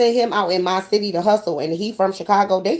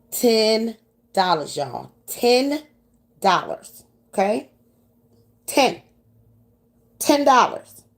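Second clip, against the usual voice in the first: "Ten dollars" drawn out, Ten punched higher; stressing ten dollars; 4 semitones higher, slower than usual. Shown as a demonstration. Telling the audience how little the sale was, stressing "Ten dollars". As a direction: lean hard on "Ten", slow and incredulous. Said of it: "Mind you, we talking about ten".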